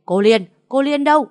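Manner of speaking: loud, high furious call for the maid; furious yell. Acted. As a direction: scream the maid's name in rage. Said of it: "điên tiết la lên". (điên tiết gào gọi người giúp việc)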